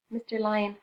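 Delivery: plain reading voice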